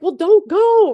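high, loud imitation of followers pleading; mock high voice. Mimicking viewers who beg her not to leave, high and loud. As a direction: squeak it out like a worried fan. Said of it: "people were like".

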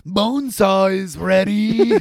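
loud, slow wrestler bellow; macho man. Acted. Host quoting a wrestler for laughs, loud macho growing hype. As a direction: stretch it out like a wrestling showman. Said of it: "That was kinda macho manish".

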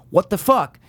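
a touch of startled disbelief; retold shock. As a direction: blurt it out, mildly alarmed. Retold shock at blood in dream.

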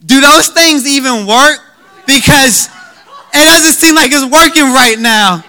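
high, loud exasperated questioning; stressed out. Retold or imitated. Frazzled new dad questions his wife about feeding, stressed out. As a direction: retell it as a frazzled new dad's outburst. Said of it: "I'm, like, stressing out".

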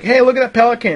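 put-on high, bright-eyed child calling out; excited kid voice. A child showing her mom a pelican, excited and high. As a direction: pitch it up like a thrilled little girl. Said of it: "a little bit of resentment in her voice".

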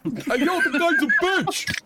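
high, loud bro heckler voice; rowdy heckler. Acted. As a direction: shout it from the crowd, laughing.

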